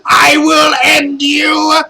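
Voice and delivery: shrieking very high and loud, slow and furious; a villain witch howling her threat at the heroes; screaming witch villain. Acted. The witch Bandora screams vengeance at the rangers, high furious shriek. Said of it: "and she goes".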